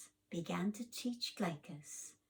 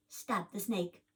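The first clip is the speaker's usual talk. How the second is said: ordinary delivery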